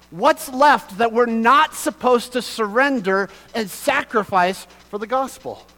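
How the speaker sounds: loud, high preacher's cry, full force; fervent preaching. Acted. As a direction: preach it out to the whole room, building to a shout.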